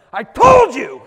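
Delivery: loud, high I-told-you-so; vindicated shout. Retold or imitated. Mom turning to dad after good news, loud triumphant shout. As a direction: crow it at your husband.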